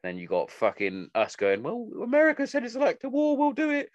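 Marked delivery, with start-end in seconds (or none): 1.6-3.9 high, put-on mocking voice of a lapdog government; mocking British government. Mocking the UK for copying America, in a high silly voice.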